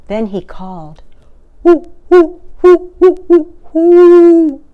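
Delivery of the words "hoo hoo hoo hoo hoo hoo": high, loud hooting owl call; long, ringing owl hoots; great horned owl call. A narrator's reading. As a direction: hoot it out like a great horned owl across the snow. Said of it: "The sound of a great horned owl".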